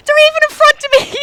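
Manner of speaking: comic panic at hearing her name called; panicked. Retold or imitated. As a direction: squeal it as if caught by strangers. Panic escalates, voice ahead.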